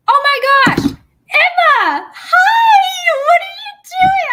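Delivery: very high, loud, thrilled greeting; delighted high-pitched squeal; excited squeal. Real, not acted. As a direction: shriek with joy at a friend.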